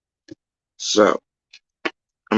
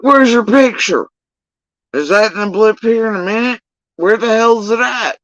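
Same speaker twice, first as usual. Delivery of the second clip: retold outrage; 11 semitones higher than usual. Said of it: "I was pissed".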